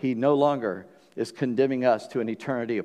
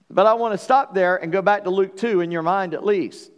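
normal talking voice

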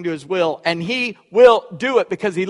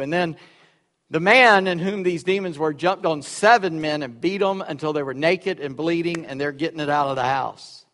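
usual speaking voice

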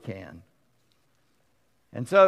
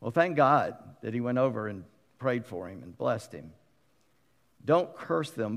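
ordinary talk, nothing marked

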